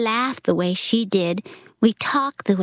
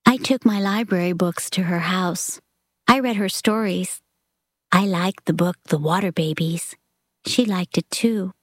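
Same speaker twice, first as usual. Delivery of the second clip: usual speaking voice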